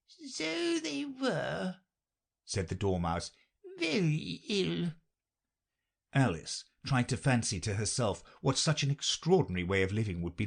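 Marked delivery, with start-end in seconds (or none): 0.1-4.9 a tiny high Dormouse, partly whispered. A narrator's reading. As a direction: squeak the story out like a tiny mouse. Dormouse answers.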